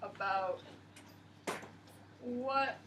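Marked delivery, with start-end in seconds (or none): none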